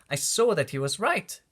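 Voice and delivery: ordinary delivery, nothing marked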